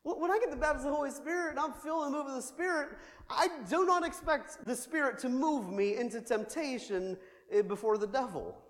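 ordinary talk

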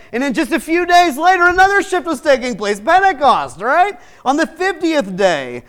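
loud, high preaching build; full-force preaching. Acted. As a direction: preach it with rising fervor.

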